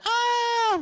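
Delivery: high, loud squealing shriek; mock groupie scream; fangirl scream. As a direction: shriek like an excited fan.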